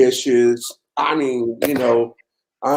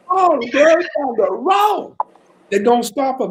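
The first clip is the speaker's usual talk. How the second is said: much higher, slow, worried wife voice; wife's anxious protest; 13 semitones higher, slower than usual. Retold or imitated. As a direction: play the nervous wife, pitched way up. Wife voiced in retold story.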